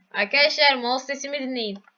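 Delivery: usual speaking voice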